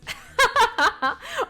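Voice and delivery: high burst of laughter; loud high laugh. Real, not acted. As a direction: cackle at your own joke. Host laughing at own joke.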